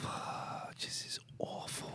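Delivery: soft, breathy whisper to yourself. Real, not acted. Viewer cringing at the soap scene, whispered. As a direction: hush it under your breath, cringing.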